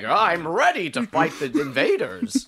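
high, loud mock battle cry; mock heroic impression. Teasing the co-host as a warrior facing invaders, mock-heroic voice. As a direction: declare it like an eager hero. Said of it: "and you're like".

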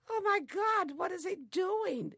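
high, loud astonished cry; shocked exclaim. Retold or imitated. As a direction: gasp at the sight. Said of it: "And we said".

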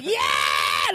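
rough mock crowd cheer; crowd roar. Comic as the club audience answering the announcer, mock roar. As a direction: roar back like a hyped-up audience. Mimicking crowd roaring back.

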